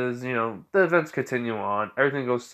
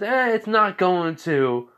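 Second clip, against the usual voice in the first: high, slow dismissive retort; acted cocky defiance; defiant reply; 7 semitones higher, 4 dB louder, slower than usual. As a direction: scoff in a raised, drawn-out voice.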